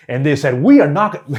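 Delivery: mock stage drama in a meeting; overdone variation. Shown as a demonstration. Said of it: "don't talk like this".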